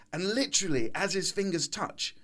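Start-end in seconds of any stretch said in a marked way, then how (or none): none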